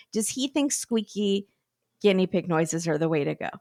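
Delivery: usual speaking voice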